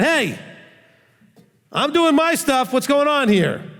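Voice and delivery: high, pushy demand; entitled demand. Retold or imitated. Pastor contrasting an entitled demand to God, pushy. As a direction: demand it like he is owed. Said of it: "It wasn't like he said".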